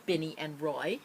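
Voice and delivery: ordinary delivery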